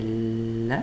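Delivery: "le" as a long held L sound; letter sound. Shown as a demonstration. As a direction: hold the L of "le", releasing into a short vowel.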